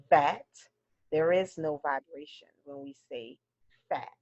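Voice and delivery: normal talking voice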